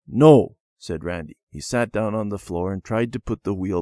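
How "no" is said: loud, abrupt cross no; cross refusal. A narrator's reading. Randy shutting out his pleading sister, cross.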